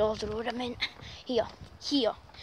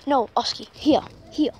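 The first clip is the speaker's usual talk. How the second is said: ordinary talk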